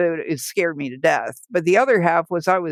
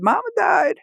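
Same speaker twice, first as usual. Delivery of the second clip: high-pitched voice of the son; retold announcement in a raised pitch; imitated son; 13 semitones higher than usual. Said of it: "and he said".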